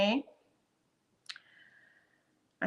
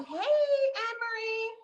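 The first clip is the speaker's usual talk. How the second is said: high, slow, drawn-out sung hello; stretched high greeting to a viewer; singsong greeting; 13 semitones higher, 5 dB quieter, slower than usual. Real, not acted. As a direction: call her name out in a lilting singsong.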